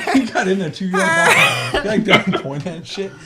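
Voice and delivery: cracking up while yelling at a friend; real laughter breaking the words; laughing shout. Real, not acted. Cracking up at the mockery.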